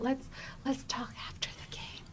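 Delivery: hushed, voiceless aside; whisper. Retold or imitated. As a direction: whisper it so nobody overhears. Coworker hushing her at work.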